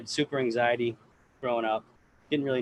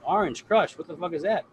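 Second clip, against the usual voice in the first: surprised; 6 semitones higher, 4 dB louder than usual. Retold or imitated. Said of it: "I'm like".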